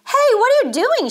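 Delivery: high, loud outraged challenge; sister's shrieky, accusing demand; indignant demand. A narrator's reading. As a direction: catch someone in the act. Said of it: "she demanded".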